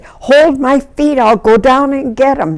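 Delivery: high, emphatic repeat all through; insistent retold order. Rescuer repeating herself to doubtful helpers, insistent.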